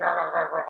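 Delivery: low imitated monster noise; demonic spider noise. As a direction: voice a big demonic spider munching. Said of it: "this big demonic spider's going".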